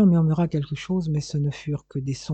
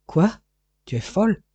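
higher-pitched startled question; shocked outcry; 5 semitones higher than usual. A narrator's reading. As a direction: cry out in sudden disbelief. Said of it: "s'écria le marchand de pierreries". (exclamation indignée et stupéfaite)

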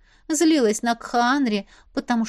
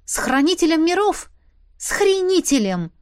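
high, loud snarling correction; bare your teeth and spit it back; sharp indignant snarl; snarled retort; 8 semitones higher, 5 dB louder than usual. A narrator's reading. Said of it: "оскалилась я".

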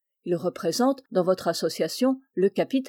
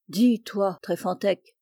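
ordinary delivery, nothing marked

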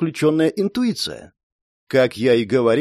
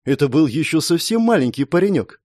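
plain reading voice

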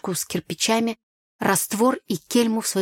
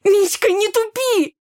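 high, loud, drawn-out scolding; cross; 14 semitones higher, 6 dB louder, slower than usual. A narrator's reading. Sister grabbing at her slow brother, cross. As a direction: snap crossly, pitched way up. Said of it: "сердилась я".

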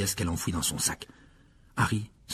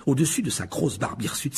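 plain reading voice

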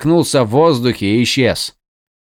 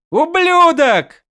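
very high, slow anguished scream; drawn-out scream; 3 dB louder, much slower than usual. A narrator's reading. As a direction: stretch the yell out in grief. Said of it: "заорал Адалин".

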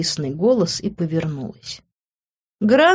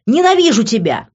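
louder, higher cry of hate; raised, heated exclamation; furious outburst; 5 semitones higher, 5 dB louder than usual. A narrator's reading. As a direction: throw it at him in anger. Furious outburst while hitting him.

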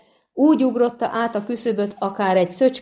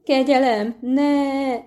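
squealing plea; 7 semitones higher, slower than usual. A narrator's reading. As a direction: squeal for mercy. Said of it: "visított fel a lény".

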